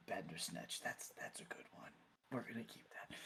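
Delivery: very soft, near-whispered aside; hushed aside. Acted. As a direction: murmur it privately to a fellow cleric. Clergy member's amused aside to others.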